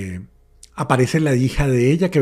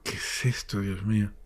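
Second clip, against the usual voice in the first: hushed whisper; 4 semitones lower, 7 dB quieter than usual. Retold or imitated. Senses presence again.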